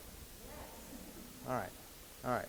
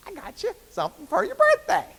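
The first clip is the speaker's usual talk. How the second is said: much higher and a bit louder, a put-on lady voice; 5 dB louder than usual. Wife offering her husband a gift, in a high voice. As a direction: chirp it up high like the eager wife. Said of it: "I got to use the high voice there".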